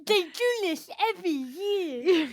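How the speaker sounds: slow, slurred cartoon sloth; Sid the Sloth. Friend quoting Ice Age to friends, Sid the Sloth. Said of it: "turns into Sid the Sloth".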